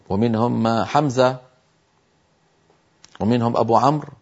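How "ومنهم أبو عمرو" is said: ordinary talk, nothing marked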